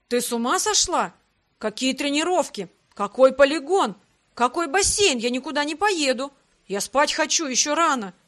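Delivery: loud, indignant string of questions; dumbfounded protest. A narrator's reading. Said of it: "оторопел Грег".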